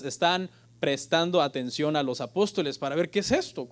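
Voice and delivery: normal talking voice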